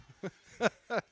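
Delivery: high, loud burst of laughter; hearty laugh. Real, not acted. Co-commentator cracks up at his own joke, laughing.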